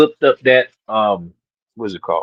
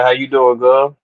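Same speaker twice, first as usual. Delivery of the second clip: ordinary talk, nothing marked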